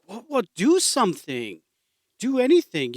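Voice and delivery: high, loud panicked pleading; panicked passengers. Retold or imitated. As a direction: yell in mock panic at the calm man. Panicking plane passengers beg pastor.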